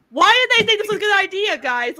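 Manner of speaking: fast, high indignant outburst; exasperated rant. Real, not acted. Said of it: "I have to go on a rant".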